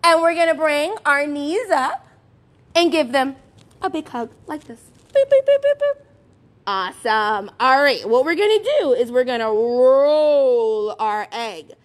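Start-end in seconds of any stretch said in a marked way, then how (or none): 5.1-6.1 high loud bouncy boops; silly boop noises; playful boops. Acted. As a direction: bop it out for the kids. Playful sound effects.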